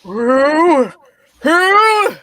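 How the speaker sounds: high, loud startled cry. Acted. Scrooge startled by the giant ghost, crying out. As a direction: jump at the voice.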